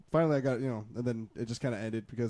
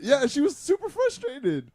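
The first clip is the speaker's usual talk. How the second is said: laughing; 6 dB louder, slower than usual. Real, not acted. Laughing recount of her anger.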